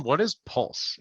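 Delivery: normal talking voice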